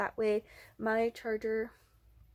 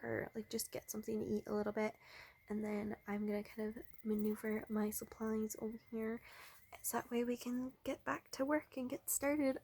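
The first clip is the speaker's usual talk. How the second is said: softer, hushed vlog chat; keeping quiet; 7 dB quieter than usual. Real, not acted. Vlogger avoiding being overheard by people nearby, quiet. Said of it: "I'm trying to be a little quiet".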